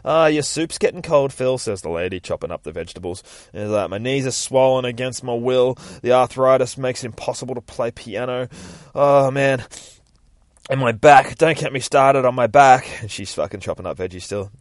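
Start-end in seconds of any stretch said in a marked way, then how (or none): 0.0-1.6 slightly hammed-up call, louder and higher; lightly acted nagging. Lady chopping vegetables to Phil, raised-voice nagging. 3.9-12.9 raised, higher moaning rant; complaining old man. Acted. Old man listing his aches, loud theatrical complaining.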